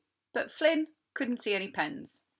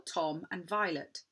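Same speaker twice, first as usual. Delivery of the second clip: usual speaking voice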